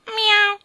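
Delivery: high, loud mew; short piercing imitation meow; cat meow. Cat promising the queen to come punctually, meow.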